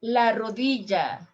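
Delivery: ordinary delivery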